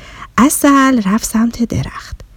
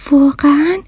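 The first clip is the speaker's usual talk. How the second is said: 1 amazed wonder; 8 semitones higher than usual. Acted. Bear marvels at story.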